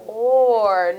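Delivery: "Or" loud and stretched long; isolated vowel sound. Shown as a demonstration. Teacher confirming the vowel to pupils, "Or" loud, elongated. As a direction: hold "Or" out so the vowel rings clear. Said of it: "What vowel sound?".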